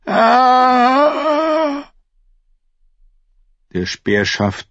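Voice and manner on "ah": long, loud, high yell of pain; acted scream as the blow lands; drawn-out high "ah" cut from speech; cry of pain. Micken struck by spear shaft.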